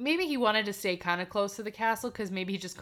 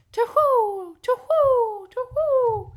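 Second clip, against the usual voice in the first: high, loud falling hoots; crying screech owl; 10 dB louder, slower than usual. A narrator's reading. Narrator voices the owl circling her, high loud hooting. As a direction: hoot like an owl, three even cries. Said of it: "A screech owl ... three times cried".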